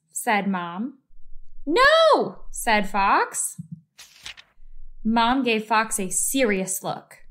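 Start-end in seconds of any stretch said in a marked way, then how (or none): 1.6-2.4 high, loud protesting no; loud refusal. Acted. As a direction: refuse outright. Fox refuses Mom.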